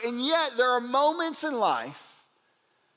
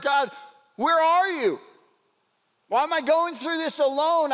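raised, anguished plea, higher and louder; crying out to God; 5 semitones higher, 4 dB louder than usual. Retold or imitated. Preacher voicing a sufferer calling out to God, anguished plea. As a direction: cry out in lament, begging to be heard. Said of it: "and you cry out".

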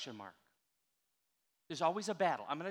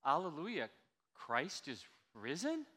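risen with a rising question tune, slow; question mark; slower than usual. Shown as a demonstration. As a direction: ask it, doubting, rising on risen. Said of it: "say it like the punctuation mark says".

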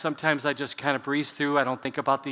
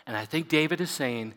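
normal talking voice

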